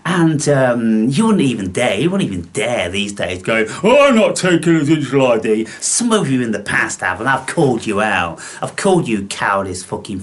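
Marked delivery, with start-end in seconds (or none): 3.8-5.7 quick mock protest, a touch higher and louder; a light, hammed-up rebel; mock defiant voice. Mocking viewers who refuse the digital ID, quick mock protest.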